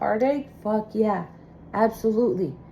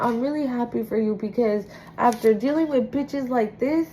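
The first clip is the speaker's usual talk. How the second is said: ordinary delivery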